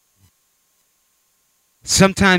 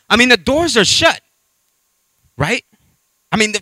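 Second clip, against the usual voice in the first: ordinary talk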